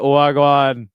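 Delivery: loud playful Jamaican patois hello; Jamaican accent. Pretend. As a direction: toss out a mock Jamaican wagwan. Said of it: "Do a Jamaican accent".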